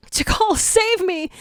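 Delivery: high, slow pleading cry for help; desperate cry. A narrator's reading. Jackal begging Tikal to save him, high desperate cry. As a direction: scream for her to save him. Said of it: "the jackal cries".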